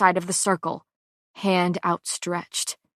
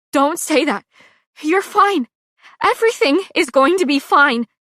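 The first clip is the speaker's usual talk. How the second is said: higher, louder tearful insisting; shaky cry; 7 semitones higher, 7 dB louder than usual. A narrator's reading. Pei insists to her dying friend she'll be fine. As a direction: insist through crying. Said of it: "a shaky cry".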